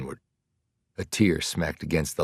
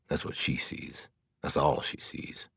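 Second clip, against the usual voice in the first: bitter whisper; 8 dB quieter than usual. Acted. Bitter inner thought.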